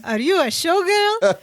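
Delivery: high put-on naive character voice; Balky. Balky questioning Wendy at the bar, high imitated voice. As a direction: pitch it well up as a character's question. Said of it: "And Balky goes".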